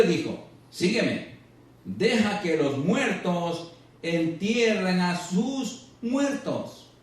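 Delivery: high, slow, declaimed gospel verse; scripture reading. Acted. Reads scripture aloud to congregation. (lectura del evangelio, proclamada con pausas)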